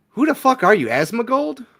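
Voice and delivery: high, loud indignant shout; shocked outburst. Acted. Guest yelling at the rude host in loud indignant disbelief. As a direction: yell it in disbelief.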